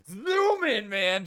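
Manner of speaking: high, loud, stretched-out word like a racing engine; excited zooming. Retold or imitated. Host likening the show to a Ferrari, loud excited zoom. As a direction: punch it up and draw it out like speed.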